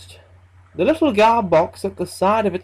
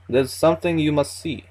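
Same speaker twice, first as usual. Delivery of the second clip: light, floaty Russian accent, lightly voiced; a hint of an old Russian man's accent, drifting; 3 semitones lower than usual. Old Vasily hinting to the narrator, in a light floaty Russian accent. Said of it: "in a floaty Russian accent".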